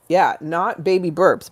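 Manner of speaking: ordinary talk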